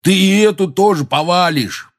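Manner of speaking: high, loud warning yell; alarmed shout. A narrator's reading. Hagrid warning the giant about the pine, shouting. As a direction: shout a scolding warning upward. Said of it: "закричал Хагрид".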